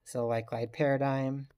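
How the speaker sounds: usual speaking voice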